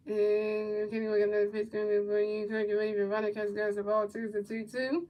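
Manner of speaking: low, quiet, steady game call; soft running commentary; play-by-play call. Acted. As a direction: murmur the pitches like a broadcaster.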